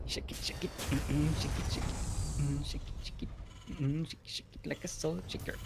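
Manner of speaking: soft, rhythmic whispering; hushed sing-along to oneself; playful whisper. Acted. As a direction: whisper it like a little groove. Playful whispered refrain on own joke.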